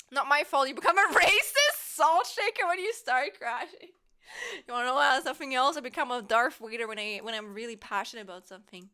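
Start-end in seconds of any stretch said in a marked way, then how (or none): none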